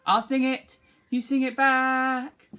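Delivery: normal talking voice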